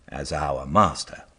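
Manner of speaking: ordinary delivery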